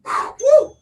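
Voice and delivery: high whoop of relief; excited whoop. Real, not acted. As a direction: cheer through the burn. End of hardest round.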